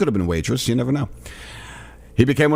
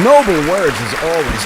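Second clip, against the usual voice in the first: sarcastic; 6 semitones higher, 7 dB louder than usual. Acted. Sarcastic praise to president.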